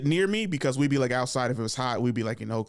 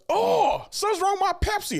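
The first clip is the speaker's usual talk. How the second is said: much higher, louder alarmed cry; mock shocked shout; 4 dB louder than usual. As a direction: shriek like a kid tasting beer. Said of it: "I was like".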